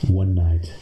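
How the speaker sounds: plain reading voice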